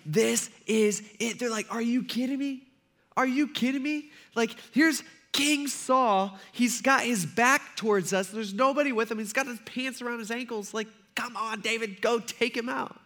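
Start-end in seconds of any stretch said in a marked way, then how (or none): 0.0-1.4 high, slow, savoring thrill; acted hushed-up elation; super excited. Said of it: "They're all super excited". 1.7-13.0 high-pitched, contained excitement; acted giddy disbelief, held in; super excited. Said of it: "They're all super excited".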